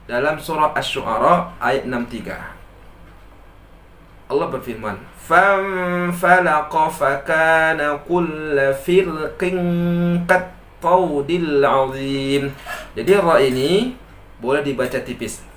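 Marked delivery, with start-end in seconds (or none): 5.3-12.4 higher chanted tilawah; Quran recitation. Acted. Teacher recites the Quran verse for students, chanted.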